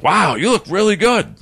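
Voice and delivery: high-pitched, impressed exclamation; retold amazement. Agent seeing him a month later, amazed. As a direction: imitate someone amazed at the change.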